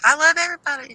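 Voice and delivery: high, sing-song innocent quote; mock sweet friend. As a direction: play her as helplessly sweet. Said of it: "She's like".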